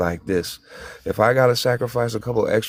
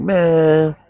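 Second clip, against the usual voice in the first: exasperated; 8 semitones higher, 6 dB louder than usual. Retold or imitated. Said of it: "I'm like".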